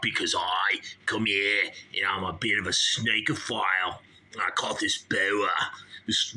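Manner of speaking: broad put-on Aussie snake handler; Australian accent. Snake handler explains himself to host, mock Australian accent. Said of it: "he's from Australia".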